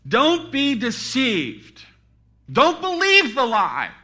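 loud, high, drawn-out command; preacher's emphatic warning cry; urgent warning. Acted. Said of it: "here's a command and here's a warning".

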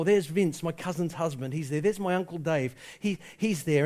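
normal talking voice

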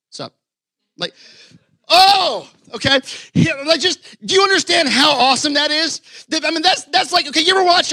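high and loud, fired up; bursting with excitement about the story; excited preaching. Real, not acted. Preacher hyping his congregation, excited and loud.

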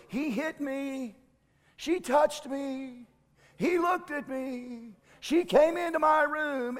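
lightly voiced tattling child, high and whiny; a hint of a squabbling kid telling on a sibling. Parent retelling kids' complaints, mock whiny tattle. Kids tattling on each other.

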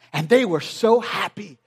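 normal talking voice

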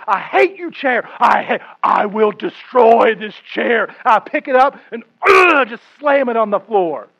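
loud, high hammed-up rage at the furniture; mock fury at a chair. Pastor acts out kicking the chair for the church, loud mock fury. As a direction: rant at the chair like it wronged you, in play.

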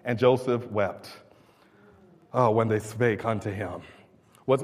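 low, soft, measured scripture reading. Acted. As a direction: read the verse solemnly, with deliberate pauses. Reads scripture aloud to congregation.

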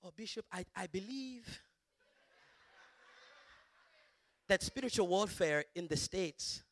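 imitating a woman's African accent. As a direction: speak as a church first lady with an African accent. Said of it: "African accent".

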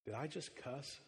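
soft, unsure little question; hushed worried question. Retold or imitated. Five-year-old daughter to dad, hushed and worried. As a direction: wonder aloud, half afraid. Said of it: "She said".

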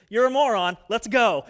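loud, rough tough-love jab; blunt scolding. Retold or imitated. Mentor dragging him back by the ear, loud tough love. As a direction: bark it like grabbing an ear. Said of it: "that was the tone that finally worked on me".

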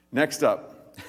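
ordinary delivery

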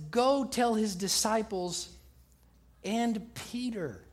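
slow, with "and Peter" set apart after a pause; pause, then draw out "and Peter"; single out "Peter" slowly after a break; stress on Peter. Acted. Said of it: "This is how you're gonna say it".

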